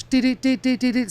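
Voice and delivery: high, loud run of dee sounds; imitated beeping. Answer to the bad-spirit question, imitated beeping. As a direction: mimic the detector firing by itself. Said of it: "starts going legit, like, by itself".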